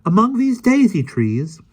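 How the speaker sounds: put-on high, excited boy voice; a boy stunned by a giant world; amazed boy. Michael surprised.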